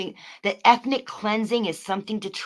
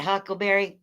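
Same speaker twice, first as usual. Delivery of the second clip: normal talking voice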